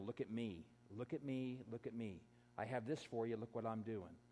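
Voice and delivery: a hushed, sneering imitation of showing off; quiet mock of self-promotion; mocking look at me. Quoting attention seekers on Instagram.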